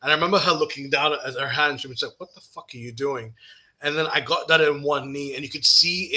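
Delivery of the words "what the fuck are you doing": low-volume, discreet challenge; retold hushed disbelief. Best friend to him as he grabs her hand, hushed disbelief. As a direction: ask it under your voice.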